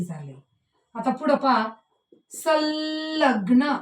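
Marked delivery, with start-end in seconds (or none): 2.3-3.7 संलग्न drawn out very slowly, ल held and stressed; slow demo pronunciation. Shown as a demonstration. Teacher stressing the ल for pupils, slowly. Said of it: "कोणता वर्ण आपल्याला ऐकायला येतोय".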